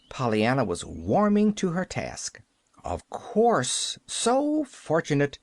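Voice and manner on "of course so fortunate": slow, high-pitched disdain; sniffy sarcasm. A narrator's reading. Man scoffing at Pollyanna's reason for gladness, sniffy. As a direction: sniff it with drawn-out mock approval. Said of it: "sniffed the man with uplifted eyebrows".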